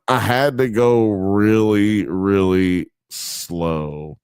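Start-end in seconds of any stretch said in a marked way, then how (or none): 1.1-4.2 very slow, stretched-out words; slowing to a long, low crawl; really slow. Shown as a demonstration. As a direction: drag out "slow" as long as it will go. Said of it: "you see how slow I was going".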